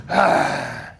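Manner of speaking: ordinary talk, nothing marked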